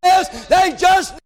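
high, driving sermon cry; full-force preaching. Real, not acted. Preacher telling congregation how blind men cried out, preaching hard. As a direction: build to a long, high peak.

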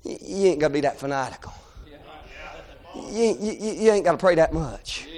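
a lightly voiced dismissive bystander; mock scoffing crowd. The crowd scolding the boys, mild scoffing voice. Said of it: "the crowd around him said".

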